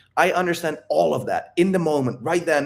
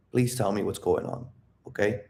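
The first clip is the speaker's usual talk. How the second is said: soft pleading; 4 semitones lower, 6 dB quieter than usual. Shown as a demonstration. Pleading girlfriend to share.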